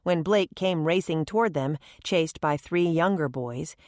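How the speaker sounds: plain reading voice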